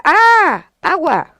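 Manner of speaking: loud, high exclamation, then the word; enseñando la palabra. Retold or imitated. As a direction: model the word loudly for a child. Madre enseña la palabra al niño. (modelar la palabra en voz alta)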